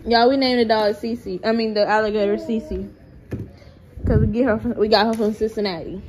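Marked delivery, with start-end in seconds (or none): none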